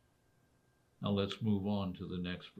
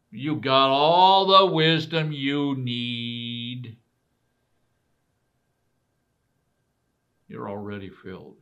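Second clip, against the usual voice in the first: sarcastic; 10 dB louder than usual. Retold or imitated. Preacher mocking the Corinthians, sarcastic. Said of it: "He's making three sarcastic statements".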